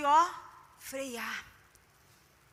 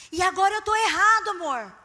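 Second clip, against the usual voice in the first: 1 indignant husband; 3 semitones higher, 7 dB louder than usual. Retold or imitated. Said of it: "husband's line repeated".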